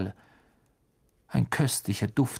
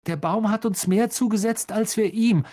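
much higher and louder blurt; 10 semitones higher, 4 dB louder than usual. Acted. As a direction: burst it out. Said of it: "sprudelte es aus Ron heraus".

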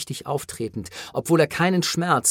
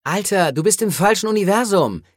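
high, loud scoff; mocking; 6 semitones higher, 5 dB louder than usual. A narrator's reading. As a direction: tell them off in mocking disbelief. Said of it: "spottete Jess".

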